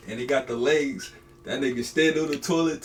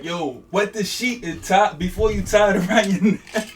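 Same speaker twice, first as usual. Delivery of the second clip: voiced high, cracking up; laughing quote; 6 semitones higher, 5 dB louder than usual. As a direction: quote him while laughing.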